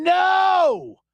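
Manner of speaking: mock frustrated yell. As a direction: blurt it like a fan watching a bad pass. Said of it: "all the breaths we take going".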